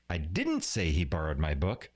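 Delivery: "didn't" punched, rest falling away; stress on DIDN'T. Shown as a demonstration. Teacher showing how emphasis changes meaning, stress on "didn't". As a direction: hit the word "didn't". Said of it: "putting emphasis on different words".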